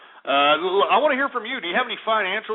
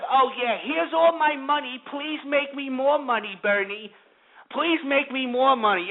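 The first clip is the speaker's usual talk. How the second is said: higher, slowed, pleading sucker voice; mock begging investor; 6 semitones higher than usual. Mocking investors pleading with Bernie, simpering. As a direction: beg Bernie like a gullible fool.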